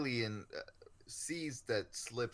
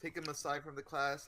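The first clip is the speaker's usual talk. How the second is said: ordinary talk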